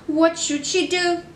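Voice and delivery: ordinary delivery, nothing marked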